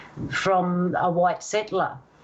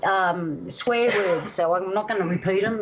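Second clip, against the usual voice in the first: ordinary delivery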